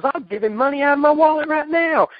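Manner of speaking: high, loud exasperated impression; Cornette impression. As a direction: squawk it like a cranky manager. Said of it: "He goes".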